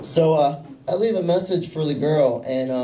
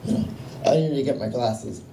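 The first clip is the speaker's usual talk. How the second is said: put-on flustered, halting Italian-accented old-school father; dad's Italian accent; 3 semitones lower than usual. Italian dad who can't see the phone, in a flustered Italian accent. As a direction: imitate an Italian dad fumbling, trailing lower. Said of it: "In his Italian accent".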